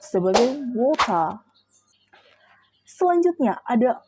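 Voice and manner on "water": "water" in a British accent, final R dropped; British pronunciation. Shown as a demonstration. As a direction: say "water" the British way, ending on an open "ah". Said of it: "yang dalam British diucapkan". ("water" dengan aksen British, tanpa bunyi R di akhir)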